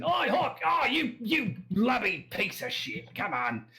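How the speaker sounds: high, jeering Australian outburst; Australian accent. Acted. Drunk local ribs Hork for drinking early, jeering Australian accent. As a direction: heckle a mate like a rowdy Australian drinker. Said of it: "that's my Australian accent".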